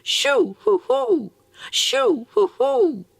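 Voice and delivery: very high, loud hooting owl call; shrill owl hoots from the bush; screech owl cry. A narrator's reading. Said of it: "cried three times".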